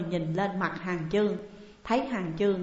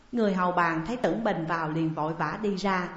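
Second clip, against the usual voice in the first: ordinary delivery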